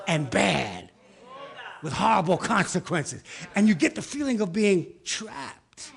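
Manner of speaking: loud, driving preacher cadence; loud rhythmic preaching; building sermon delivery. Acted. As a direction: hammer each line home. Preaching at full force.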